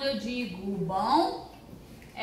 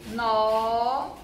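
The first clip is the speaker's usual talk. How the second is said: "nó" drawn out on its own; separated syllable; 2 semitones lower than usual. Shown as a demonstration. Teacher splitting binóculo, middle syllable held alone. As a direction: hold the open vowel of "nó". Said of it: "sabem separar as sílabas".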